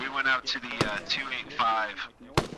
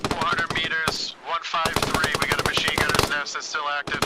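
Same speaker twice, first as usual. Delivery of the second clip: shouted callout; 5 semitones higher, 4 dB louder than usual. Real, not acted. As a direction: shout the position over gunfire. Calling out enemy positions in combat.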